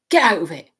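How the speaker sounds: loud angry shove-off; shouted at the cat, angry. A narrator's reading. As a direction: snap at the cat to get away. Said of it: "shoved Cruikshanks away angrily".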